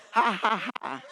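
spoken-out, emphatic ha-has; preacher's prompting laugh; deliberate laughter. Shown as a demonstration. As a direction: laugh it out on purpose, beat by beat. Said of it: "Laugh, Monica".

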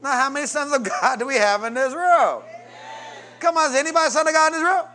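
loud high repeat, shouting; shouted. Shown as a demonstration. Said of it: "Oh, about five".

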